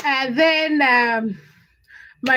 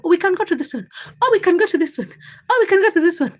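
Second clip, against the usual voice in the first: retold pestering, higher and building louder; eager suggesting; 6 semitones higher, 3 dB louder, faster than usual. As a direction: list options in an insistent, repeating tune. Retells repeated suggestions to boyfriend.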